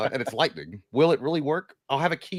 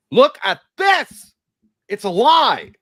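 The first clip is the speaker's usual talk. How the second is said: dramatic reveal; 11 semitones higher, 9 dB louder, much slower than usual. Acted.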